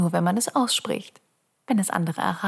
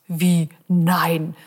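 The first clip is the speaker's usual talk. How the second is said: indignant loud; 6 dB louder than usual. Acted. Mock dialogue with listener.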